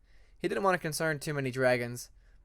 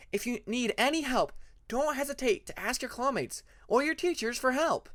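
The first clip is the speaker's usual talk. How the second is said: lightly voiced higher, gentle teacher; a hint of a caring teacher, higher up; kind teacher Sunny; 8 semitones higher than usual. Teacher Sunny reassuring her new student, lightly higher voice.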